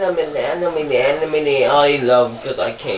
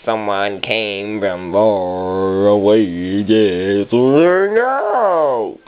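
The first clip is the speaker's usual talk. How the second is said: slow drawl; 7 semitones lower, much slower than usual. Pretend. Slow drawn out.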